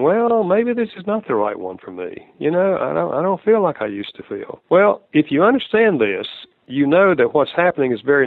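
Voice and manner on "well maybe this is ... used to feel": higher, musing inner voice of a doubting partner; mock-wistful second thoughts; doubting single. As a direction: voice the unsure thought, lifted high. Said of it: "they start thinking".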